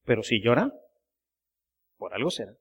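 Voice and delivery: low, hushed, measured retelling; quietly noncommittal quoted answer; understated quoted reply. Retold or imitated. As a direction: drop the voice well down, trailing very soft. Retold papal answer, knowingly understated.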